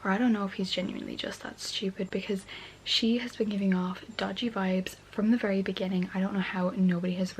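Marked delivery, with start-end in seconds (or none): 2.1-5.6 late-night whisper; hushed night-time vlog whisper. Real, not acted. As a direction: whisper so as not to wake anyone.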